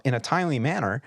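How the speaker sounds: ordinary delivery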